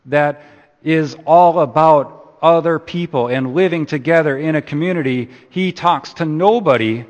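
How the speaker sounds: impassioned preacher raising his voice over the congregation; rising fervent preaching. Acted. Preacher builds to fervent point.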